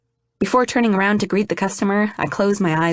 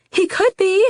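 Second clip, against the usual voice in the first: high, loud desperate yell; 4 dB louder than usual. A narrator's reading. Grieving girl yelling at her friend on the phone. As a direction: shout it, clinging to hope. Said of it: "I yell".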